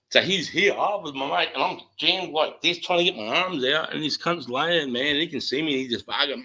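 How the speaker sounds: rising excitement reliving the brawl; excited, high retelling. Retold or imitated. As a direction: pitch climbs high as the fight story builds.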